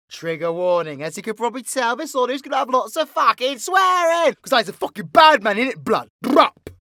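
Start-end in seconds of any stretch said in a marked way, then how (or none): 2.0-4.3 hammed-up. Hammed-up warning of swearing. 4.4-6.7 loud, hammed-up street tough; big mock gangster bravado; mock bad man. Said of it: "Fucking never doing that again".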